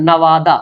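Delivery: नवादा ending open "-daa", no "h"; as spoken. Shown as a demonstration. Teacher showing how it is read, नवादा as "na-waa-daa". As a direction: leave the "h" off नवादा. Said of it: "क्या पढ़ते हैं".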